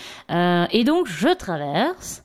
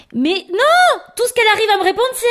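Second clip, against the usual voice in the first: much louder and higher, exasperated; outraged higher cry; indignant outburst; 12 semitones higher, 6 dB louder than usual. Real, not acted. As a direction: blow up in disbelief. Indignant outburst in comic rant.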